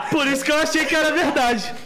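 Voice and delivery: laughing it off in relief. Real, not acted. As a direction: talk through a laugh.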